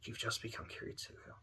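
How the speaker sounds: soft, fading to near whisper; quiet pity. Acted. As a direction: pity them in a hushed voice. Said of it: "I really pity you".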